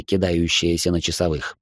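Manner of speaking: plain reading voice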